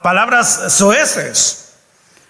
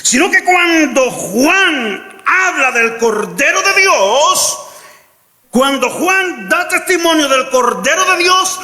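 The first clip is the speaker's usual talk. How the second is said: rising sermon fervour, near shouting; preaching at full force; 5 semitones higher than usual. Acted. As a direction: preach it out to the congregation.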